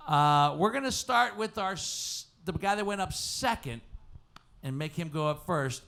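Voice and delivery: ordinary talk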